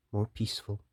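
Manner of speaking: ordinary delivery, nothing marked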